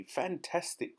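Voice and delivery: high-pitched delighted exclamation; high, thrilled exclamation; excited cry. A narrator's reading. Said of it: "cried the pelican".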